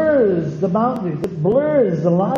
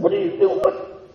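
angry retelling; 11 semitones higher than usual. Retold or imitated. Preacher retelling yelling at his wife, mock angry. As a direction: snap at wife over spilled chicken, high pitched. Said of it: "I got mad too".

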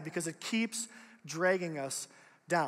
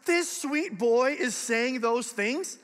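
disbelief; 4 semitones higher, 4 dB louder, much slower than usual. Retold or imitated. Dad's disbelief at son.

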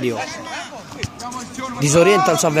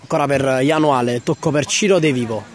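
ordinary delivery

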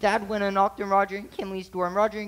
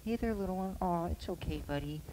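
softer, tender hush to a kitten; gentle coaxing; 7 dB quieter than usual. Shown as a demonstration. Big sibling shows the soothing tone, soft and tender. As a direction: coax the frightened cat softly. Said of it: "you can practice it".

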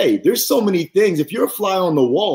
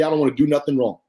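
ordinary delivery

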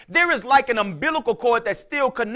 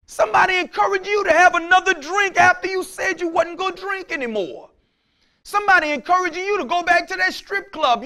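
fiery warning; 7 semitones higher than usual. Acted. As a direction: warn them hard. Preaching at full force.

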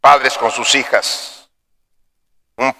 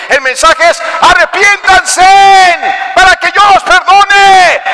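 high, loud, slow call to repent; preacher urging the congregation to repent; preaching at full force; 6 dB louder than usual. Acted. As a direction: plead and thunder at the crowd.

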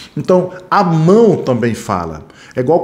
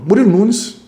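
hurried, blurting the name out high; nervous candidate tripping over his own name; rushed answer; 8 semitones higher than usual. Shown as a demonstration. Candidate rushing his name at the interviewer, nervous and hurried. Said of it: "fala devagar".

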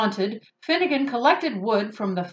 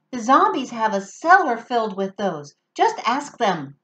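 little werewolf voice; 2 semitones higher than usual. Acted.

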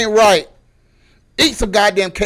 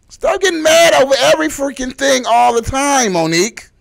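raised, loud, high scolding; exasperated scolding; 7 semitones higher, 5 dB louder than usual. Acted. Ranter fed up with her anger, exasperated scolding. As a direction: scold loudly with the pitch pushed up.

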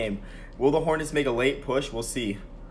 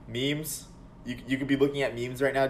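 ordinary delivery

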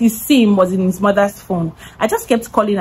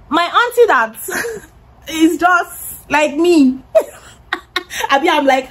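high-pitched, amused, broken by laughs; real giggle breaking up the sentence; laughing; 8 semitones higher than usual. Real, not acted. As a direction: laugh through the words. Amused while telling of auntie.

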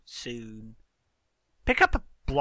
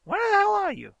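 exasperated calling out; faster than usual. Real, not acted. Calling after a lost player.

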